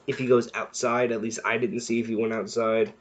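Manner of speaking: ordinary delivery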